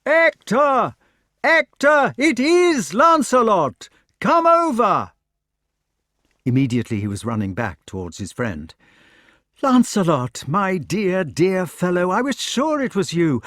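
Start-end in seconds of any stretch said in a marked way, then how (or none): 0.0-5.1 much higher, much louder joyful yell; shouting with all his might. Acted. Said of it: "shouted with all his might". 9.6-13.4 high, eager, bursting with joy; overjoyed. Acted. Overjoyed knight greets lost friend.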